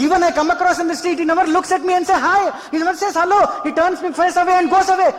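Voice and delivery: high-pitched, rapid-fire mock grievance; indignant tirade of an unthanked helper; acted resentful complaint. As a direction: reel off the slights, pitch held high.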